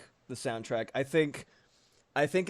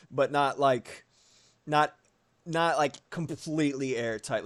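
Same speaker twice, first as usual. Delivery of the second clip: usual speaking voice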